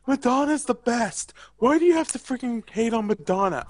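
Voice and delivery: high, loud whiny fan voice; mock angry fan. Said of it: "I'm gonna have someone be like".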